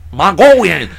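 put-on Mongolian accent on one word; hammed-up fake Mongolian pronunciation; mock Mongolian accent. Mocking accents on certain words, fake Mongolian accent. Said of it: "start going into accents".

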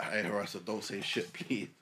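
usual speaking voice

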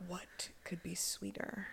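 slow, voiceless whisper; whispered quote. Retold or imitated. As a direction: savor the line in a hushed, drawn-out whisper. Breathy echo of film line.

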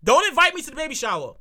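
normal talking voice

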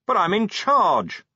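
high, slow, indignant protest. A narrator's reading. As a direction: drag it out as an aggrieved objection. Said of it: "Vimes protested".

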